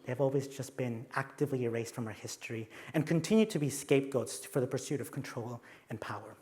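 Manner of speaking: normal talking voice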